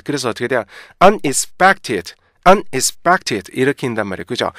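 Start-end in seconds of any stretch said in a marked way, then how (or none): 1.0-3.5 "unexpected" slow, in hard separate chunks; heavy, stiff misreading. Shown as a demonstration. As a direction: land heavily on un, ex and pected. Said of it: "굉장히 무겁고 딱딱하고". ("unexpected"를 무겁고 딱딱하게 끊어서)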